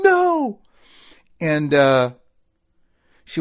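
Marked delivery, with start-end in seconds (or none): 0.0-0.6 high, loud drawn-out refusal; retold cry of dismay; horrified shout. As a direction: wail a protest like a dreading kid. Boys horrified at going back.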